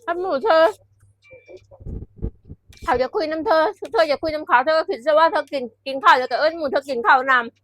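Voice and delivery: slowing down at the end of a mock-friend tip; friend's advice. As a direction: act out the friend's pushy advice. Quoting friend's eating advice.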